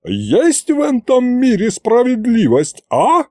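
put-on old woman's high, aggrieved rant. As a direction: demand justice like a wronged granny. Yaguta rants at Fedya.

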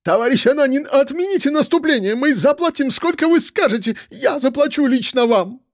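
high-pitched desperate pleading; frantic begging. A narrator's reading. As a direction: implore on your knees, pitched high. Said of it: "падая на колени".

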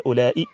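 "أولئك" said as ulaa-i, ending in short i; final kasra. Shown as a demonstration. Teacher giving the first dialect, "أولئك" ending in kasra. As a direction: end "أولئك" on hamza plus i, no k. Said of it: "أن تبنى على الكسر".

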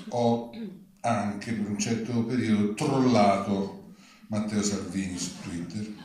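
normal talking voice

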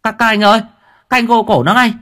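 high, loud, fast panicked yell. Acted. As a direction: shout for the police. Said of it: "người dân gào lên". (gào to, cao, hoảng hốt)

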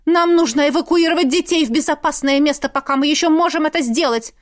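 high-pitched pleading insistence; urgent exclamation. A narrator's reading. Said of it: "воскликнула мама". (взволнованное восклицание)

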